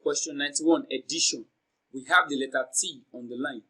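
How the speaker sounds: normal talking voice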